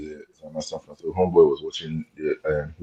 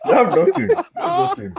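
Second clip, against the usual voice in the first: much higher, louder mocking cry of "They have nothing"; mocking squeal; 5 dB louder than usual. Real, not acted. Mocking the Nigeria team to friends, a high mocking squeal. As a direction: shriek it out in mock disbelief.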